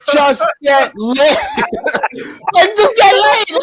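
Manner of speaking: high, laughing, slow get laid; laughing plea. Real, not acted. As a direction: crack up while begging him to just get laid. Laughing pleading at friend.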